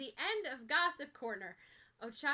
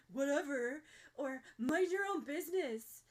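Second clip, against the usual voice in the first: mild grouchy imitation; 3 semitones higher, 4 dB louder than usual. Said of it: "a mild imitation of Bakugo's grouchiest tone".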